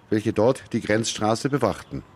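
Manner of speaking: ordinary talk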